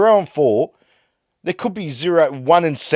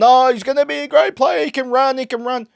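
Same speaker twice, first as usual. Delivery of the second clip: mocking high sing-song of fan hype; lightly voiced mock praise; 12 semitones higher than usual. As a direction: parrot the pundits with scorn. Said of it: "I've had enough of these Ollie Hollands and".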